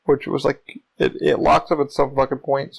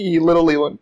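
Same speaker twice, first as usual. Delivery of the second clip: ordinary delivery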